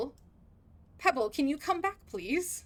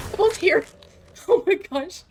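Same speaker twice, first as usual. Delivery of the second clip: loud excited squeal; high, loud thrilled gasp; 6 semitones higher, 10 dB louder than usual. Real, not acted. Shocked by a surprise arrival.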